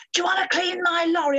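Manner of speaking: high, loud cheeky call from a passing lorry; lorry driver calling out to Kim as she cleans the sign, high and loud; lorry drivers shouting. Retold or imitated. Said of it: "there's lorries are shouting".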